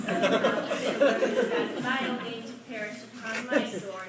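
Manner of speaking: furious vow. Acted.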